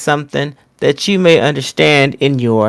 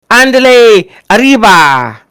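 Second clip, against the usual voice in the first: mock Spanish exclamation; 5 semitones higher, 4 dB louder than usual. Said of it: "Let me say it in your native tongue".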